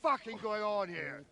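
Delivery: high, sharp demand to stop; angry demand. Acted. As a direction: bark it at the brawlers. Elder breaks up the brawl.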